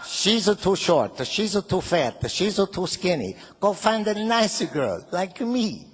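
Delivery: put-on slow Italian-accented mamma; Italian accent, disapproving mother. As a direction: scold like an old Italian mother. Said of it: "my little Italian mother".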